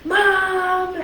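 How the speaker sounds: high, whiny fake-crying call for mom; a kid putting on crybaby tears to get his sister in trouble; little brother fake crying. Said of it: "he'd start crying. He's like".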